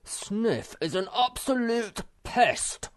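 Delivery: high, slow, emphatic outburst; furious. A narrator's reading. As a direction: spit out each word in fury. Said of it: "said Moomin Troll furiously".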